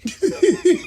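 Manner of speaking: a burst of high laughter; real laugh. Real, not acted. Laughing at her own joke about her late father. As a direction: crack up at your own joke.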